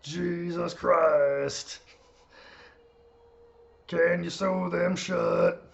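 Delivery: very slow Kermit-like voice with a rumble; Taker voice like Kermit. Pretend. Streamer borrows a friend's Undertaker voice, slow rumbling Kermit croak. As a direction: croak it slowly like a rumbling frog. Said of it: "Steven Larson's Taker voice".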